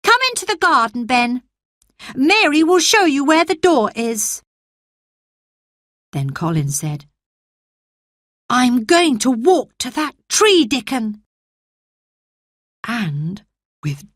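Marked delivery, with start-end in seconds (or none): 0.0-4.4 put-on a frail boy promising himself he'll live; sickly boy Colin. 8.5-11.2 put-on a frail boy promising himself he'll live; sickly boy Colin.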